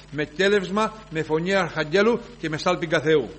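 loud, high preaching to the congregation; fervent preaching. Real, not acted. Preacher to congregation about resurrection, fervent preaching. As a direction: proclaim the resurrection with full force.

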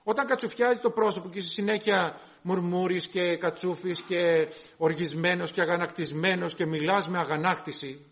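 ordinary delivery

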